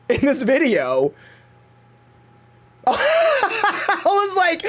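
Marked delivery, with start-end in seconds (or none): 2.9-4.6 high, slow, through laughter; laughing. Real, not acted. Laughing at how worked up the rant got, high and slow. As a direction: laugh at yourself mid-rant.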